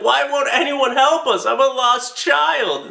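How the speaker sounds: put-on high, slow whiny child plea; pitiful lost child. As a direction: beg like a lost little kid. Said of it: "They're like".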